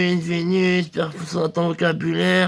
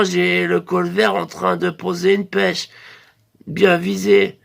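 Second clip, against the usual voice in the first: ordinary talk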